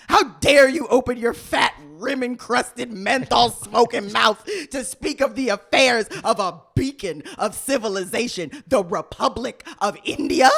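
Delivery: put-on loud Indian accent rant; all caps shouting. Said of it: "Caps, all caps".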